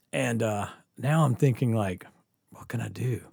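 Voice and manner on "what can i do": hushed, panicky inner voice; whispered thought. Retold or imitated. Teen panicking inside as the cop stands by, whispered. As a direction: whisper it to yourself.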